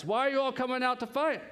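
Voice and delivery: high, projected challenge; mock warrior's jeer; shouted taunt. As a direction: call out a taunt across a battlefield. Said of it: "stood and shouted a taunt across".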